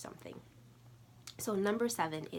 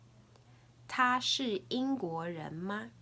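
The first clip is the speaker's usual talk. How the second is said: slow, higher-pitched Mandarin question for learners; deliberate teacher's demonstration, pitched up; slow question model; 5 semitones higher, 4 dB louder, slower than usual. Shown as a demonstration. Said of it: "I'll say it three times".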